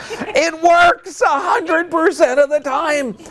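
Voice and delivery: high, slow, emphatic holler; exultant high-pitched cry to the room; triumphant shout. Real, not acted. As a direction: proclaim it at full voice, drawing out the words. Triumphant claim after live demonstration.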